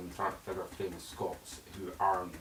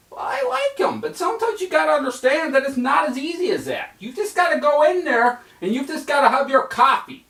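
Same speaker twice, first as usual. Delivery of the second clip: much higher and louder mouse voice; insistent cartoon mouse; Stuart Little voice; 10 dB louder than usual. Stuart Little pushing Snowball into the cafe, high mouse voice.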